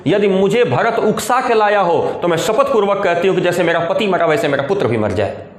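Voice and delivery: normal talking voice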